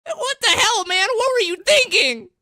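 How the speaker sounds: very high, loud squeaky yell; cracking pubescent voice. Retold or imitated. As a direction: imitate an upset teenager's voice breaking. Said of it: "Dude cracked six octaves at once".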